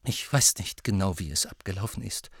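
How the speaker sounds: ordinary delivery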